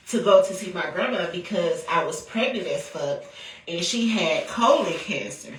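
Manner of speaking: ordinary talk, nothing marked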